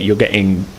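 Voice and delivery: normal talking voice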